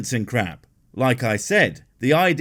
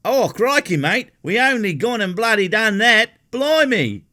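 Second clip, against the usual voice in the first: loud, high hammed-up Australian accent; over-the-top Australian crowing about science; mock Aussie accent; 9 semitones higher, 5 dB louder than usual. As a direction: shout it like a cartoon Aussie, higher and louder. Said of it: "Okay, I'll stop now".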